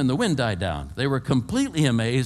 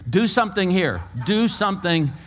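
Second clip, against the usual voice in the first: raised, higher, insistent demand; bold; 6 semitones higher than usual. Retold or imitated. Praying man who knows God is there, boldly demanding. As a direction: boldly press for action. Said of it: "I get more bold".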